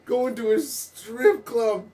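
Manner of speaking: ordinary talk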